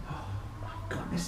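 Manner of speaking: usual speaking voice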